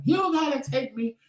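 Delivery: high, urgent demand to go to the ER; frantic plea. Retold or imitated. Calling Tramel for a ride, high frantic urgency. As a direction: panic on the phone to a friend.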